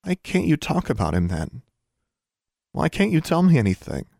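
high, building, pleading protest; upset pleading. A narrator's reading. Daughter demanding the truth from mother, upset pleading. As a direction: plead for answers, voice climbing.

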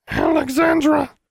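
very high raven squawk of a name; loud, high, drawn-out bird voice; cooing raven. A narrator's reading. Raven calling into Alexandra's ear, high squawky coo. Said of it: "Charlie cooed sadly into her other ear".

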